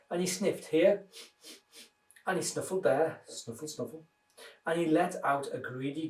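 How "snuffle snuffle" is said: soft sniffing snuffle, almost silent; hushed snuffling wolf. Acted. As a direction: sniff it out quietly. Sniffing sound words.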